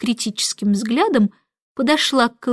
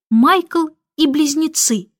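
usual speaking voice

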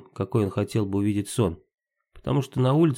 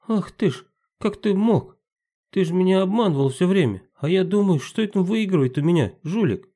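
put-on indignant boy; 9 semitones higher, 4 dB louder than usual. Boy Maksim accuses Timka.

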